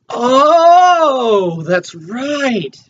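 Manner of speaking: high, loud, drawn-out oh of realisation; excited realisation. Real, not acted. Remembering what they did in the post show, excited. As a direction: swoop up loudly as it dawns on you.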